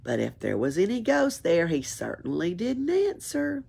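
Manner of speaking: plain reading voice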